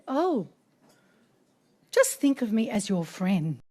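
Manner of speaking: soft, kindly imitated reassurance; gentle comforting. His Holiness consoling sobbing producer, gentle comforting. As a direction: imitate a tender elder soothing someone.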